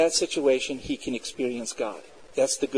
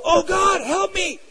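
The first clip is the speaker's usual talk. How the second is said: much higher, imitated panicked yelp for help; spontaneous cry out; slower than usual. Atheist student as his lab equipment breaks, high cry out. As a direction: blurt out a high-pitched desperate plea. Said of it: "he cried out".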